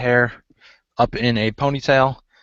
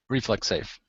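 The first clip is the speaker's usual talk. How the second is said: ordinary delivery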